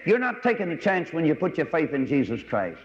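ordinary talk

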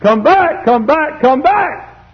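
high, loud pleading calls; sudden high holler of summons; urgent calling. Acted. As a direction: cry it out from the pulpit. Calls escalate to a cry.

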